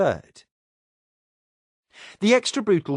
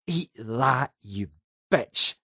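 snarl; slower than usual. Acted. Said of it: "Julia looked up and snarled".

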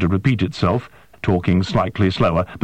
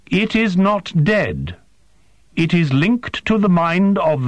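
higher, stiff and formal alien voice; alien interpreter; 8 semitones higher than usual. A narrator's reading. Interpreter questions the human, high and formal. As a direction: ask with precise, careful, unhuman politeness.

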